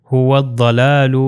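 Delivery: هو الضلال slowly as "huwad-dalaalu", heavy daad; هو الضلال with a doubled thick d; waw joined to daad. Shown as a demonstration. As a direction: recite هو الضلال drawn out, lam silent. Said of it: "یہ جو واو جو ہے ضاد سے مل جائے گا".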